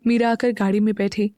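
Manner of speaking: usual speaking voice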